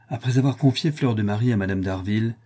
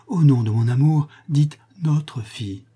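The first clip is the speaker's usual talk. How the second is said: high, heartfelt entreaty slowing at the end; implore, lingering on the last words; moved, pleading; 5 semitones higher, 4 dB louder than usual. A narrator's reading. Rodolphe implores Clémence to say our daughter, pleading. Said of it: "d'une voix émue et suppliante".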